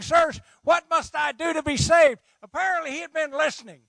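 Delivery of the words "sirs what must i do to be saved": desperate cry. Acted. Jailer's desperate plea, acted.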